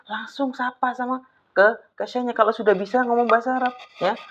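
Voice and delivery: ordinary delivery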